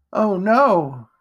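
high, loud dismay; horrified realisation. A narrator's reading. Jack sees Edgar bleeding, horrified. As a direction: cry out in alarm at what you did.

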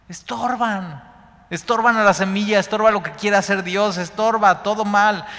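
fiery sermon delivery driving the verse home; full-force preaching. Acted. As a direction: preach it out to the congregation.

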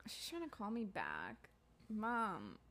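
hushed whisper. Real, not acted. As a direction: whisper the name under the breath. Phone ringing back, whispers aside.

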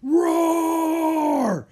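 loud, high, hammed-up roar; boy playing a big fierce cat; scary lion roar. A narrator's reading. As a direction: roar like a lion to scare a warthog. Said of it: "made a very loud, scary noise".

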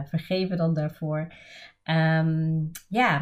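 ordinary delivery

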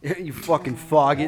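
put-on Boston accent. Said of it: "can you guys do a Boston?".

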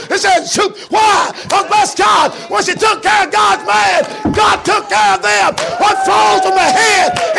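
high, roaring sermon shout; chanted, shouted pulpit climax; full-force preaching. Acted. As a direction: belt the story out at the top of your voice.

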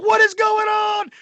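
put-on shouting in shock as a drunk woman pulls a broken glass; alarmed shout. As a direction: yell it in disbelief as a fight breaks out. Brawl erupting in restaurant.

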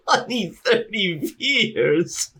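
incredulous laugh; laughing as he repeats it; laughing disbelief. Real, not acted.

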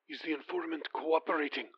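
a prince on the phone, quiet and commanding; commanding near-whisper. Acted. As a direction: give the order in a breath, quiet and firm. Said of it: "just above a whisper, though clearly commanding".

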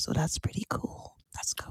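soft breathy whisper. Real, not acted. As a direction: whisper it like ASMR. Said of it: "No, she did A S M R".

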